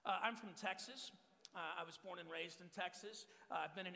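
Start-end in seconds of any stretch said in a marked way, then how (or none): none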